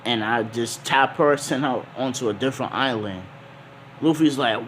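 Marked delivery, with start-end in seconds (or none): none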